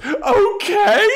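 high, loud mock-furious squeal; mad. Imitating Anya before she storms out, mad high shriek. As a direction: shriek it in exaggerated outrage. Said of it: "she's so mad that he's okay".

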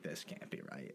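breathy whispered disbelief; whispered stunned dismay. Retold or imitated. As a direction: murmur it to yourself.

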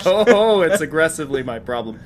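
laughing at yourself; breathless giggling admission. Real, not acted. Laughing at own stumble.

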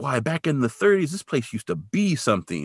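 a hint of a quirky old man reminiscing; funny-talking old guy. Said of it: "the guy talks funny".

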